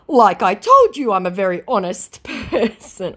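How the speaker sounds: high mock-earnest voice; hammed-up protest breaking into a laugh; protesting scammer.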